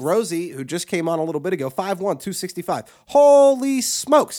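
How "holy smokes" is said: slow, high, loud hammed-up gasp of shock; big slow exaggerated amazement; mock astonishment. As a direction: drag it out like you can't believe the numbers. Mock shock at caller's numbers.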